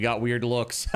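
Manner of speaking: ordinary delivery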